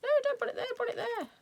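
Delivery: high-pitched mock outcry from the audience; imagined viewer. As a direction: squeal at her like a fussy viewer. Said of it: "you're probably sitting there thinking".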